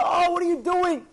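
high, loud mock outburst; hammed-up angry shout; angry reaction. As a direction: yell indignantly at whoever spilled on you. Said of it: "if a person gets angry".

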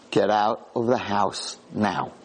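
low, slow, drawn-out command; each word a heavy warning; slow stern command. Retold or imitated. Retold angel speaking Ivrit to the soldiers, slow and stern.

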